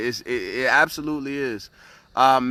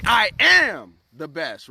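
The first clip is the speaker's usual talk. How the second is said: louder, slow, emphatic "I am the best"; with conviction; 2 semitones higher, 4 dB louder, slower than usual. Shown as a demonstration. Coach showing viewers the right affirmation, loud and slow. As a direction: declare it like you mean it, slowly. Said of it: "You wanna say".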